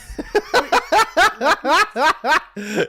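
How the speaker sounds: high, loud burst of laughter; real high-pitched belly laugh. Real, not acted. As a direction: burst out laughing at full volume. Reacts to comic fight definition.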